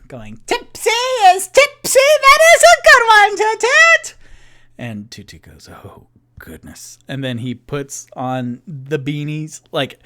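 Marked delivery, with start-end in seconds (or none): 0.5-4.1 put-on drunken shout, very high and loud; even louder. Tipsy drunk cheering on Toot Toot, even louder. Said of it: "then he gets even louder". 5.7-7.0 low, very soft murmur; small hushed reaction under the breath; quiet dismay. Acted. Toot Toot recoils from loud Tipsy.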